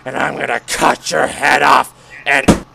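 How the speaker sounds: low, slow threatening ghost voice; menacing ghost. Acted. Ghost threatening the guard, low and slow. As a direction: creep the threat out slowly.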